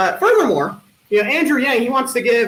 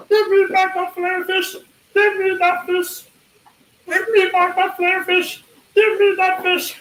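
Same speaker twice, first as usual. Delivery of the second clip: high, strange singing fish voice; Filet-O-Fish commercial fish; 8 semitones higher than usual. Retold or imitated. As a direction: belt it like the singing wall fish. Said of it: "this impression is the old McDonald's Filet-O-Fish commercial".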